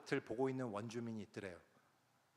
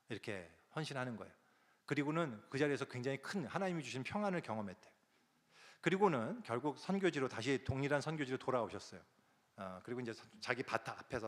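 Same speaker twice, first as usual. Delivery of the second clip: usual speaking voice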